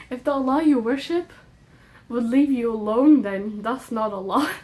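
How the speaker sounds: ordinary delivery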